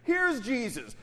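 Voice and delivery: usual speaking voice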